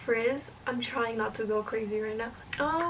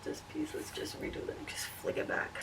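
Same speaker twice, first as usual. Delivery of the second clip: whisper; 3 semitones higher, 10 dB quieter than usual. Real, not acted. Whispering own hair step.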